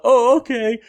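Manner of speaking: eager agreement. Retold or imitated. Retold eager agreement in huddle.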